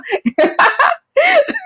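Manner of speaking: real high-pitched laugh; hearty laugh. Real, not acted. Laughing as they recall translating at church, hearty laugh. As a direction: burst out laughing at your own memory.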